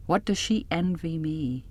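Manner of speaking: plain reading voice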